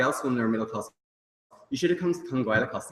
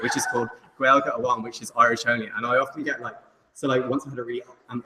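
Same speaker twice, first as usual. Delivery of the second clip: ordinary talk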